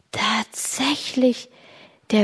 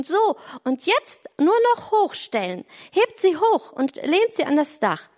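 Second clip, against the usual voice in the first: high-pitched directions called from above; calling down instructions; 6 semitones higher than usual. A narrator's reading. Boy on the roof instructs two girls, high call. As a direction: direct the helpers in a high, raised voice.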